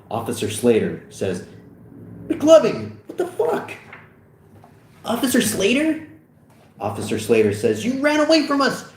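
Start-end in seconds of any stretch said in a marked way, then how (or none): 2.3-3.7 loud high exclamation jumping higher; incredulous high-pitched outcry; shocked officer. Acted. Officer shocked at finding him. 5.0-6.1 high, rising disbelief; squeaky startled query; startled question. Acted. Fogel startled by officer. 7.9-8.9 high-pitched indignant yell; outraged high outburst; angry accusation. Acted. Officer accuses Fogel.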